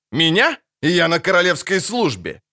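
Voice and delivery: mildly barked indignation at being threatened. A narrator's reading. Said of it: "рявкнул он". (возмущённо, рявкая вполсилы)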